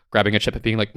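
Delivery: ordinary talk, nothing marked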